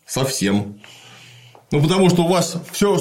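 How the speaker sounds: ordinary talk, nothing marked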